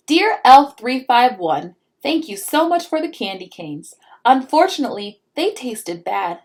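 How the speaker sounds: put-on a little girl politely asking; child's letter. Pinkalicious writes to the elf, pleading child voice. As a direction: read the letter out, sweetly pleading.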